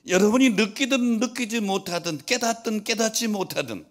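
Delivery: very high, loud sustained proclamation; full-force preaching. Real, not acted. Preacher pressing the congregation about an unfailing promise, full-force preaching. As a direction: drive each phrase home at full voice.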